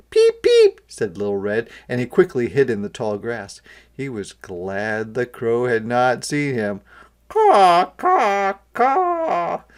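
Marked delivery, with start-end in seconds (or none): 0.1-0.8 very high, loud peep; a sharp little chick peep. A narrator's reading. Little Red peeping in alarm, very high. 7.3-9.6 high, loud caws; crow cawing. A narrator's reading. Old crow cawing over the meadow, loud caws.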